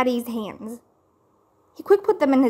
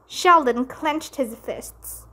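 usual speaking voice

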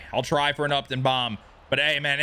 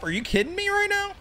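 very high, rushed protest; indignant disbelief; faster than usual. Real, not acted. Streamer hearing his player called overrated, high indignant outburst. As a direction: squeak it fast in disbelief.

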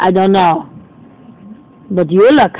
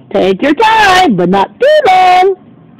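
high, slow squeaky fish voice with a cry in it; fishy voice; 8 semitones higher, slower than usual. Pretend. Magic fish telling doggy he gets one wish, high and slow.

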